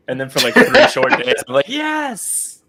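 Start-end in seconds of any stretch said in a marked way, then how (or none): 1.7-2.6 high, happy yelp of joy; excited cheer. Retold or imitated. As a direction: cheer like friends just arrived. Retold cheer on seeing friends.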